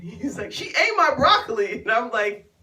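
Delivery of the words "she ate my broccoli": high-pitched wounded complaint; hammed-up whine, pitched high; mock tattling. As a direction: imitate an aggrieved man telling on her. Imitating man tattling at table.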